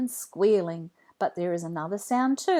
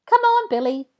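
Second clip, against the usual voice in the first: put-on high, bright kid voice, calling out; lively child greeting; 8 dB louder than usual. A narrator's reading. Jack calls out to his friend Rebecca, high and loud. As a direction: call hello like an excited kid.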